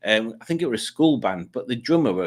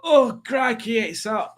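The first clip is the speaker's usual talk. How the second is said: much higher, strained moan about the heat; mock complaint; 11 semitones higher, slower than usual. Walking through a field in baking sun, high whining moan. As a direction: whine it high like you're melting. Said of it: "in baking sun like this".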